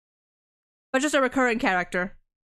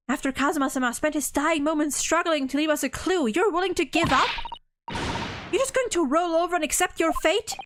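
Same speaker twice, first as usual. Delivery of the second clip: put-on raised-pitch young woman's voice, lightly done; assistant's indignant reproach; 6 semitones higher than usual. Assistant rebukes the hero for giving up, lightly voiced indignation. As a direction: scold him for giving up.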